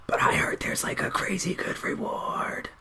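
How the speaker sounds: very soft, breath-only whisper; conspiratorial whisper. Acted. Tiefling telling dwarf about a reward, secretive whisper. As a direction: whisper it like juicy gossip. Acted secretive gossip at table.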